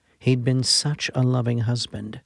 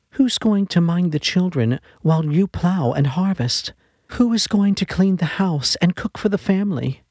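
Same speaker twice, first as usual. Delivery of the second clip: higher, a little louder, pressing; pleading wife; 7 semitones higher, 4 dB louder than usual. A narrator's reading. Dying wife pressing her husband about the children, higher urgent voice. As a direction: press him with urgent questions.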